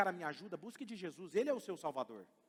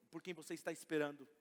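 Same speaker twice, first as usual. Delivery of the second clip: ordinary talk, nothing marked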